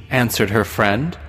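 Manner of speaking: plain reading voice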